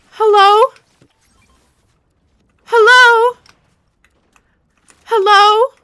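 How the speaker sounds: high, loud call. Real, not acted. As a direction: call out to find someone. Calling out in game.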